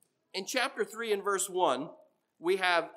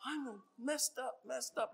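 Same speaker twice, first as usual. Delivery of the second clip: distraught worker; 8 semitones higher, 8 dB quieter than usual. Retold or imitated. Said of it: "they're just distraught".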